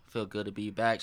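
normal talking voice

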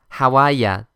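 Boston dialect, r dropped in "are"; Boston accent. Shown as a demonstration. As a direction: say "How are ya" like a Bostonian, ending on "ya". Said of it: "ボストンの方言で言うから". (ボストン方言で)

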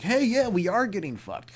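high, loud shout of sudden realization; hammed-up high agreement; mock crowd reaction. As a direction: holler back like a won-over onlooker. Crowd answering the stuntman's call.

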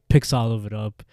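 ordinary talk, nothing marked

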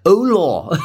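high, hammed-up English accent on Spanish. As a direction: mangle the Spanish greeting with a mock English accent. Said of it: "trying to mix the English accent with, like, Spanish".

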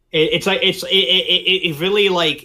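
tripping over words in a rush; flustered. Real, not acted. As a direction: keep restarting, worked up. Struggling for words, emotional.

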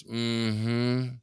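long, drawn-out level hum; disinterested mm-hmm. Retold or imitated. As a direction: imitate a friend's uninterested acknowledgement. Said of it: "all they do is go like this".

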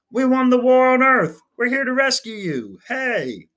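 high, loud triumphant shout across the valley. A narrator's reading. Rescue men shouting to the empty town, loud and high. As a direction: yell it out as you leap from the rocket. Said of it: "men leaped out of it shouting".

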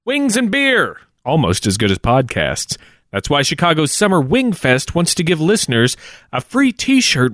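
upbeat sponsor read, bright and bouncy. Acted. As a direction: sell it like a radio spot.

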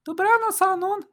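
high falsetto goodnight, not whispered; Kasia's bedtime voice. A narrator's reading. Kasia to friend in bed, high falsetto. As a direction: say goodnight in a high put-on female voice. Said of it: "szepnęła ci do ucha".

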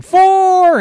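loud golfer's yell; shouting. Acted. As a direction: yell it across the course. Golfers yelling in story.

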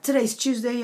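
ordinary delivery

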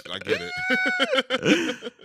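I get it, stretched very slow and high; mock-understanding, teasing and drawn out; sly sarcastic tease; sarcastic drawl. Real, not acted. Teasing friend, sarcastic drawn-out line.

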